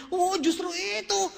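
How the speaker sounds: high, slow, drawn-out mock enthusiasm; evasive salesman. Speaker answers who got a car, high drawn-out mock enthusiasm. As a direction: stretch out a hammed-up sales answer.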